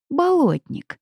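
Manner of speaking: high, falling exclamation; delighted surprise. A narrator's reading. Heroine recognising the swamp creature, delighted surprise. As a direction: cry it out high in pleased surprise. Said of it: "от неожиданности я прижала ладони к щекам и улыбнулась".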